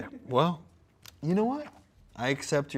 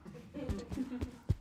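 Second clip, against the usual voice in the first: high laugh; 12 semitones higher, 9 dB quieter than usual. Real, not acted. Laughing at metaphor.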